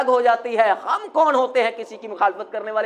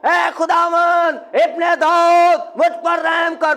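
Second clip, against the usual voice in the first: crying out; 3 semitones higher, slower than usual. Shown as a demonstration. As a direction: call out to the Lord as if from far away. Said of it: "پکارنا ایسے، ایسے پکارا جاتا ہے".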